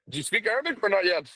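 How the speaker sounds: normal talking voice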